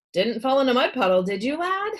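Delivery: quick teasing question to the lad; doctor quizzing the boy, brisk; jolly doctor. A narrator's reading.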